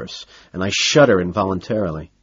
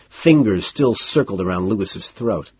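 ordinary delivery, nothing marked

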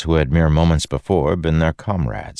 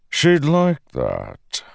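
lightly voiced menacing god; a hint of a smooth villain; Odium voice; 14 semitones higher, much slower than usual.

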